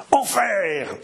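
high, sudden roar; roared curse. A narrator's reading. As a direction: roar it out over a noisy crowd. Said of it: "rugit Crucé". (juron rugi)

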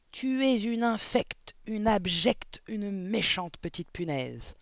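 high, slow, drawn-out outrage; furious, stretched-out denunciation; howled insults. A narrator's reading. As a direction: spit out each insult separately. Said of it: "hurla mademoiselle Le Gourdin".

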